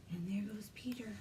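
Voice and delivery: soft, hushed telling; whisper. Acted. Librarian hushes the children at the chase, whispering. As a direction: breathe it like a secret.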